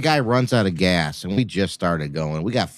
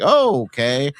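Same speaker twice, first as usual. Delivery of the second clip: mock surprise; 7 semitones higher, 6 dB louder, slower than usual. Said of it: "I'm like".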